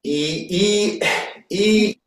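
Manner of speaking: high, held Liverpool E; Liverpool vowel sound. Shown as a demonstration. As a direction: show a Liverpudlian singer's vowel. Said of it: "if you sing the Beatles song and you're from Liverpool".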